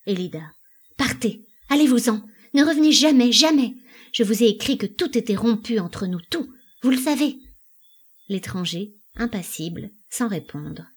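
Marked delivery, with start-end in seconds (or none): 1.0-7.4 loud, high-pitched banishing; agitated dismissal. A narrator's reading. Elida orders her old love away, agitated. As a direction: drive him away with rising force.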